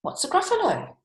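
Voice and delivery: high-pitched story-character question; lifted, inquiring snake voice; curious snake. Acted. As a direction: ask it high, as a puzzled snake.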